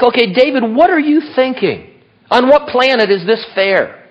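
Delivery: high-pitched, exasperated questioning; incredulous challenge. Acted. Reader questioning David's fairness, incredulous.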